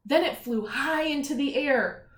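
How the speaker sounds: storybook reading with energy; story narration. A narrator's reading. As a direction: read it out for the kids. Reads story to children.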